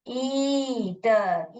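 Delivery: very slow "ईटर" with a long held ई; drawn-out demonstration of "ईटर"; sounded-out syllables. Shown as a demonstration. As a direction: stretch ई, then add टर in "ईटर". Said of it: "ईटर आ रहा है".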